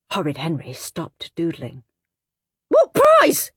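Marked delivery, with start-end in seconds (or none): 2.7-3.5 high sudden shriek. Acted. Boy in class shrieking about the prize. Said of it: "he shrieked".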